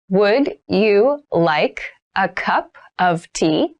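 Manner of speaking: slow, careful offer for learners; slow model read. Shown as a demonstration. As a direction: offer it slowly so students can copy. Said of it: "teacher models sentence, third take".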